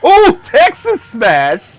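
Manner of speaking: slow, drawn-out hype shout; excited shout. Real, not acted. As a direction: call out the signature move. Calling out the move name.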